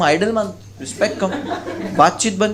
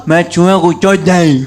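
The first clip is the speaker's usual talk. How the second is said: put-on slow, louder, mangled words of a shocked boy; shaken, garbled survivor voice; 3 semitones higher, 8 dB louder, slower than usual. Shown as a demonstration. Boy back from the haunted school, dazed and slurring. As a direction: blurt it out slowly, dazed and garbled. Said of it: "वो ऐसे नहीं बोला".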